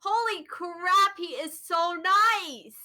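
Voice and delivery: high, drawn-out exclamation; gushing praise. Real, not acted. Fan on what makes the channel addicting, gushing. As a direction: stretch it out with emphatic, high-pitched admiration. Gushing over the YouTuber's personality.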